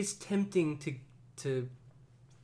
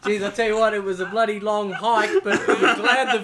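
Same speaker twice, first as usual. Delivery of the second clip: laughing; 5 semitones higher, 8 dB louder than usual. Pretend. Joking exhausted hiker voice.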